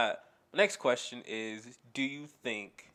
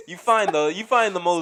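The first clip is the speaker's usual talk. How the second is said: loud, high and worked up; raised-voice comic ranting; yelling; 5 semitones higher, 11 dB louder than usual. Real, not acted. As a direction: yell over the laughter. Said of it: "Stop yelling at me".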